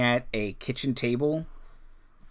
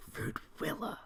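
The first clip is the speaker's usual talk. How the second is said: hissed whispered name through clenched teeth; whisper through gritted teeth; 9 semitones higher, 14 dB quieter than usual. Acted. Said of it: "whisper a name".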